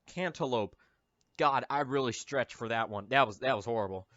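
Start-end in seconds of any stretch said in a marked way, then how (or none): none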